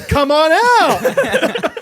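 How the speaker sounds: high, stretched and loud, laughing; laughing welcome. Pretend. Dad calling the baby out, slow and laughing. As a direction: beckon the baby playfully.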